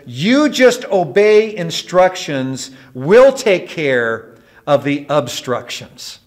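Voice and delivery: loud, high, slowly measured order; stern voice. Acted. Air traffic controller interrupts the panicking pilot over radio, stern. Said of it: "with a stern voice".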